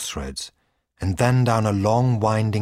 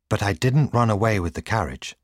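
usual speaking voice

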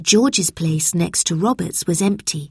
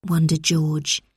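ordinary delivery, nothing marked

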